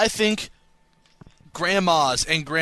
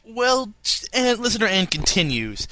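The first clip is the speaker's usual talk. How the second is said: usual speaking voice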